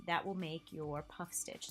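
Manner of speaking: ordinary talk, nothing marked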